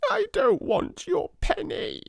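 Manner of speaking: put-on very high tearful little girl; sobbing child. A narrator's reading. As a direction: refuse it through sobs. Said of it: "sobbed Lottie".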